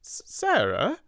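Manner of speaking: high stammered "S-, Sarah?"; stammering. Acted. Amelia, bewildered by her angry sister, stammering. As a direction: stumble over the name, flustered. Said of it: "she stammered".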